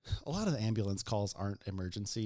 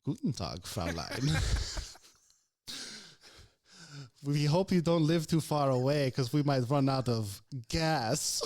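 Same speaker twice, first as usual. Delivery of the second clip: hammed-up German accent; mock German officer; 3 semitones higher, 5 dB louder than usual. What the frightened old woman hears from the EMT, mock German accent. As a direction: play a comic menacing German. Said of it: "all she hears is".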